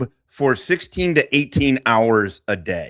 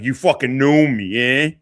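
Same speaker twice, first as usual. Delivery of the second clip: mock Italian mobster accent, tough-guy swagger; lightly hammed Italian wiseguy accent; fake Italian tough guy. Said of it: "Fake Italian tough guy".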